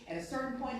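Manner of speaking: usual speaking voice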